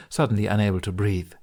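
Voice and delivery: ordinary delivery, nothing marked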